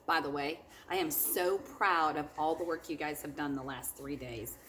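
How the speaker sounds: ordinary delivery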